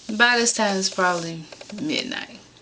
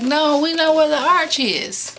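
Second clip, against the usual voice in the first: quoting the callers; 8 semitones higher, 5 dB louder than usual. Retold or imitated. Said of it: "They was like".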